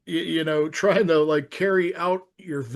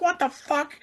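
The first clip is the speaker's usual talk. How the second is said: mock yelling; 10 semitones higher than usual. Alone screaming at the TV, high mock yell. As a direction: re-enact yelling at the screen, held back in volume. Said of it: "I'm screaming at the T V".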